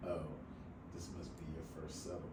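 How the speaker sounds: imitated low, very soft, quick put-down; deflating. Older man to eager newcomer at settlement table, deflating. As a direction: deflate them with a quiet, low, unimpressed remark. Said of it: "deflated all my excitement".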